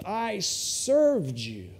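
slow, cold flat statement; curt older son. Retold or imitated. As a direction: say it coldly and slowly. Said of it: "He just says".

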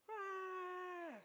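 long, high imitated wail; sustained mock infant cry; baby's cry. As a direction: mimic a baby wailing the moment the hand lifts. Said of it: "screams, cries, hollering".

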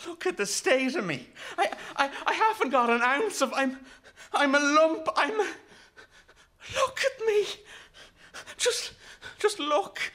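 very high, tearful, broken stammer; boy breaking down in halting bursts; voice cracking. A narrator's reading. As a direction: build to a desperate, near-sobbing outburst. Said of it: "his voice cracking".

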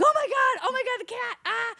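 high-pitched mock panic; hammed-up, high panicked cry; freaking out. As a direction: squeal it in acted alarm. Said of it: "I start freaking out".

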